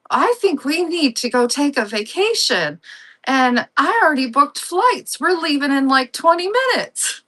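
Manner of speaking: dad's announcement, voiced high; acted dad. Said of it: "the dad walks in. He's like".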